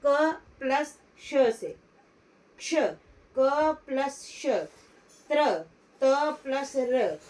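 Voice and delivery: क प्लस श and त plus र spelled out slowly, each part stressed; teacher building the conjunct sound by sound; slow spelling out. Shown as a demonstration. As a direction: spell out क्ष and त्र slowly for the class. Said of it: "क्ष कैसे बना?".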